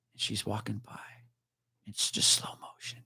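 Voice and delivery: hushed whisper. Retold or imitated. Cop retells a woman walking past him, in a soft whisper. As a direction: drop to a whisper as she passes. Hushed romantic moment in story.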